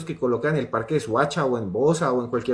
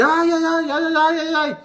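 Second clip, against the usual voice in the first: imitating a panicked kid's high wail; shrill, sustained mock squeal, far above normal pitch; chillido de niño asustado; 4 dB louder than usual. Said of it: "deje de chillar". (chillido agudo de niño aterrado, imitado)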